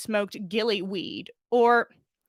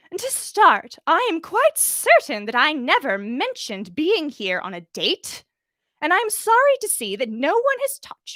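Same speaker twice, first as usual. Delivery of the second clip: high, prissy, louder reprimand; prim scolding; 4 semitones higher, 4 dB louder than usual. Acted. Umbridge lecturing the rude server, prim and shrill. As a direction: scold like a sugary headmistress.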